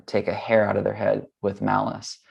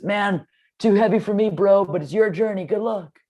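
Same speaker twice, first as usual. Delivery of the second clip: much higher, easygoing dude voice; laid-back surfer bro; 11 semitones higher, 3 dB louder than usual. Retold or imitated. Friend calls back from the departing truck, high laid-back bro voice. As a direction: toss it off like a carefree bro driving away.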